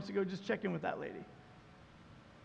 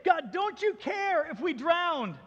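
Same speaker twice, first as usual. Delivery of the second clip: panicked disciples; 6 semitones higher, 3 dB louder than usual. Retold or imitated. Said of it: "they basically just say".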